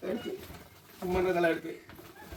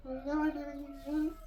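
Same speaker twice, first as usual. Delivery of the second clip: drawn out, high, slow sobbing wail; real tears, stretched out slowly; crying, wailing; 13 semitones higher, 4 dB quieter, much slower than usual. Real, not acted. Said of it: "நீ ஏன் அழுகுற?". (மெதுவாக நீண்ட அழுகை)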